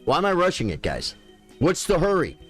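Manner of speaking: usual speaking voice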